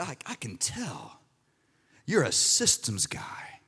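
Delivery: slow, hushed whisper like a mystic; mock prophetic whisper. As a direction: lean in and breathe the prophecy slowly. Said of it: "He said".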